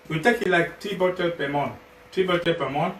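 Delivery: ordinary talk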